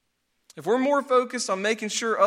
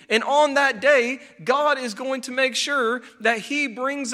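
raised, emphatic sermon proclamation; forceful preaching; 4 semitones higher, 3 dB louder than usual. Real, not acted. Preacher promising God's justice to the congregation, forceful preaching. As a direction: proclaim it to the congregation, pitched up and weighty.

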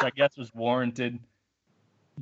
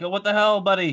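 higher-pitched retold protest; mock indignant; 7 semitones higher than usual. Knocked-down pedestrian protesting to the driver, mock indignant. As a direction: imitate an affronted man protesting.

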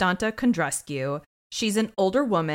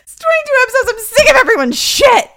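mock fed-up rant; 12 dB louder than usual. Continuing mock rant, laughing.

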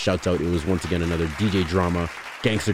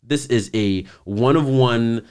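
louder, higher, deliberate mock setting-straight; slow, emphatic retold comeback; imitated pointed correction; 5 semitones higher, 5 dB louder, slower than usual. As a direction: set the interviewer straight, imitating an offended star. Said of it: "she made sure to let her know".

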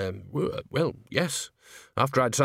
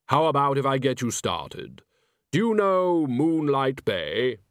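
Death voice; 3 semitones higher than usual. Acted.

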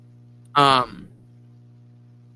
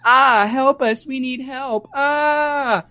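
high, drawn-out cries for help; slow, high-pitched imitation scream; mock screaming; 9 semitones higher, much slower than usual. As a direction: act out the girls' fake screams. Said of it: "they start doing this".

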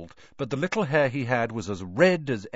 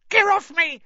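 high shrill squeal of a swung gnome; 9 dB louder than usual. Acted. Gnome squealing at Ron swinging it, loud high squeal.

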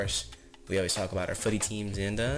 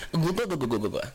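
mock choking laughter; 6 semitones higher than usual. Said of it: "it had me like".

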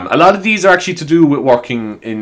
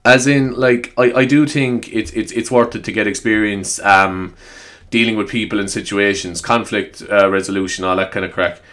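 ordinary talk

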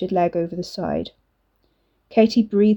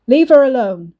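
shout; 5 semitones higher, 9 dB louder than usual. Acted. Brother shouts to protect sister.

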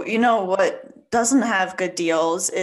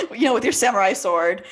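laughing; 9 semitones higher than usual. Real, not acted. Laughing at own joke.

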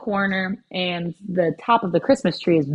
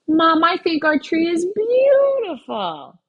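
put-on much higher, slow small-girl voice; sweet little girl; 12 semitones higher, 4 dB louder, slower than usual. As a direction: comfort mom like a tender three-year-old. Little girl comforting mom in story.

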